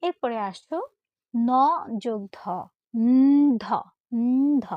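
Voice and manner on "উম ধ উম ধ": loud held nasal hum, then breathy-released ধ; উম, ধ sounded "nnn-dho", twice; conjunct pronunciation demo. Shown as a demonstration. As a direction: stretch the n before landing on ধ. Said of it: "কিরকম উচ্চারণটা".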